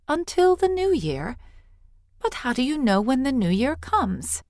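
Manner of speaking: put-on high, eager child asking; a curious little girl, pitched high; young girl's voice. A narrator's reading. Girl questioning the old priestess, high and eager.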